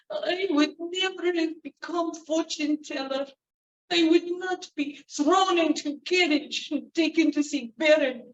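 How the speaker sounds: put-on high, lamenting old woman. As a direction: moan and rock in misery. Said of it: "she began to moan and rock, muttering to herself".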